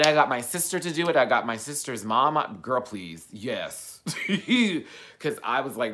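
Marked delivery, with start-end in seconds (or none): none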